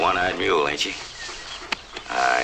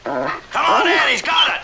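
calling out; 4 dB louder, slower than usual. Acted. Calling others to follow dog.

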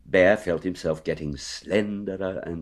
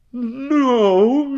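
high, airy, drawn-out denial; careless; 7 dB louder than usual. A narrator's reading. Pooh denying to Rabbit that he's stuck, careless. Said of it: "said Winnie the Pooh carelessly".

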